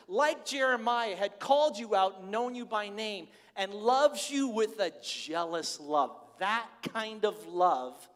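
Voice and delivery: fervent preaching. Real, not acted. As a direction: preach it with mounting fire. Preaching at full force.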